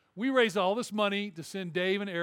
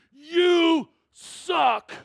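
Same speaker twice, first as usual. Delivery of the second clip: high, loud jeering yell; heckler's shout; 10 semitones higher, 11 dB louder than usual. Retold or imitated. Fan in first row yelling at Dave. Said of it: "looks down at Dave Wilson and goes".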